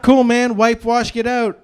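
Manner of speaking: comic hurried instructions hollered through a door; calling back. Retold or imitated.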